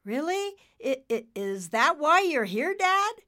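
high, stammering nervous questions; suddenly nervous. Acted. Nimbus questioning Dad about Michael, nervous. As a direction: stammer it out, pitch climbing. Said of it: "Nimbus was suddenly nervous".

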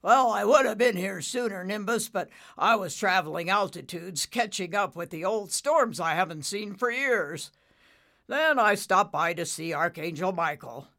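loud, hearty dad voice; booming father cloud. Acted. Father explaining his late arrival, loud and hearty. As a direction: project like a big storm-cloud father.

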